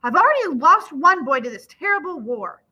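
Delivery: loud, high-pitched anguished outburst; thundering. A narrator's reading. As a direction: thunder it out in grief and anger. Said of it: "his father thunders".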